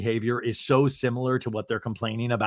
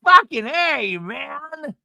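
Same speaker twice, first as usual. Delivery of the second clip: mock exasperation; 12 semitones higher, much slower than usual. [name] exasperated.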